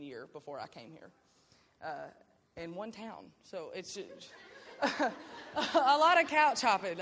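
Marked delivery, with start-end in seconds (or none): 4.4-5.0 a quick unvoiced laugh at herself; short laugh. Real, not acted. Laughing at her own story. 5.0-7.0 said through a laugh, louder and slower. Real, not acted. Laughing at her own story.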